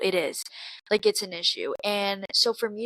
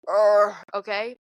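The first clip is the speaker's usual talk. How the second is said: frustrated groan; 6 dB louder, slower than usual. Real, not acted. Frustrated groan after a joke.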